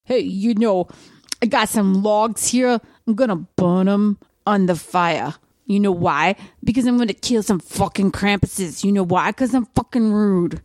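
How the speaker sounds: put-on brash, rude Northern US accent; mock tough Northerner accent. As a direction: play a pushy Northerner with dropped Rs. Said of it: "Now be a northerner".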